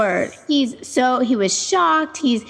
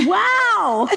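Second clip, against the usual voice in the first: much higher surprised wow into laughter; 9 semitones higher than usual. Real, not acted. Friend reacting to son saying no mama, high surprised wow. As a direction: gasp then a high amazed wow.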